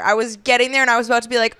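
ordinary talk, nothing marked

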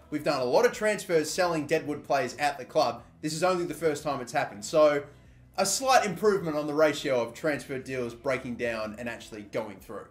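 normal talking voice

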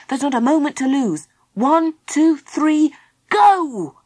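urgent, high count building to a shout; eager leader's call to action; rallying countdown. Acted. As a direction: count off briskly and launch the last word. Philip rallies them and counts off.

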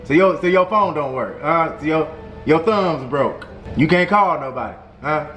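normal talking voice

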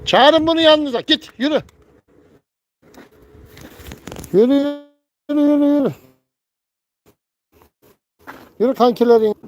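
high, loud yell to drive it off; angry shouting at a stray bird. Real, not acted. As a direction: shoo it away, shouting. Shouting a bird away from the coop.

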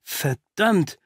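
stunned, halting outburst; stammering shock. A narrator's reading. Watson recognizes the intruder, stammering in shock. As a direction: trip over the words in disbelief. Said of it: "stammelte ich".

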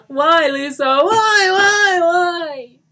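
high, screaming wail of despair; anguished cry. Acted. Johnny cries out for Lisa, wailing in despair. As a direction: wail each word at full volume. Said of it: "he cried out".

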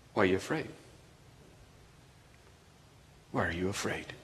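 Jesus quietly asking his frightened disciples; gentle question. Acted. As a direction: ask it gently, almost sadly.

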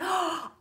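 big, high gasp of delight. Acted. Host reading a flattering viewer comment, delighted gasp. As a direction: gasp as if thrilled.

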